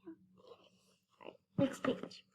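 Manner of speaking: soft whisper; quiet aside. Real, not acted. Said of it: "aside to child".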